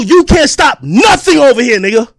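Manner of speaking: usual speaking voice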